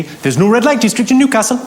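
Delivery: lilting Newcastle cabbie; Geordie accent. Retold or imitated. Cab driver to passenger in Newcastle, sing-song Geordie. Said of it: "that's how they talk".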